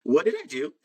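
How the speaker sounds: high, half-laughing question; sheepish bewilderment. Retold or imitated. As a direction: play clueless about last night, amused. Retold asking worried housemates, laughs after.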